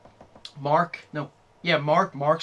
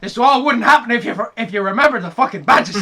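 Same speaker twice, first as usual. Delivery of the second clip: higher, louder indignant outburst; mock angry rant; 7 semitones higher, 9 dB louder than usual. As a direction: rant at them like Agnes. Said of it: "she is like".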